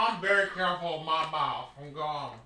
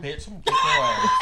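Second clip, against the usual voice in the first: high shrieking mock threat, laughing; mock rant. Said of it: "I was trying to do a rant".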